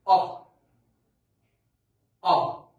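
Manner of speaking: آه said loud with an open ah. Shown as a demonstration. Teacher modelling the ah sound for students. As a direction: belt out آه as the model vowel. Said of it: "I'll say it like".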